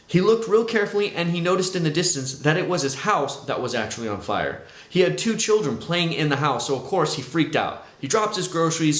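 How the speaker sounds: ordinary talk, nothing marked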